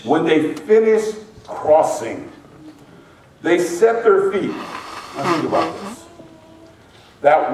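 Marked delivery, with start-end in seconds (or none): 0.0-2.3 finished drawn out slowly with weight; stress on finished. Shown as a demonstration. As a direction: land hard and slow on finished. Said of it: "and I'm emphasizing that word".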